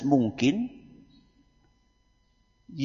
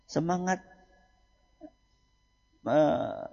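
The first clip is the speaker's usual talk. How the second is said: normal talking voice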